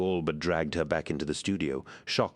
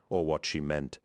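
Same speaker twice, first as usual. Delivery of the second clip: ordinary delivery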